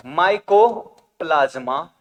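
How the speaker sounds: Mycoplasma drawn out in two parts, micro then plasma; slow dictation. Shown as a demonstration. Teacher dictating mycoplasma to students, slow and split. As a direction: say mycoplasma slowly, breaking it after micro.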